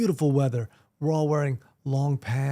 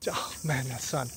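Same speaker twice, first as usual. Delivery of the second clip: whispered aside; 4 semitones lower, 9 dB quieter than usual. Real, not acted. Rueful aside after quote.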